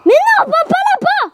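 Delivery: high-pitched cry of alarm; panicked warning. Real, not acted. Player stopping a friend going the wrong way, panicked warning. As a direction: desperately call them back. Warns friend away in game.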